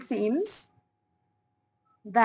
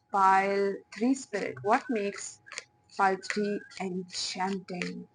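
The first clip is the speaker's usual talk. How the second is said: hushed channeling voice. Acted. Reader asking spirit about pile three, hushed and mystical.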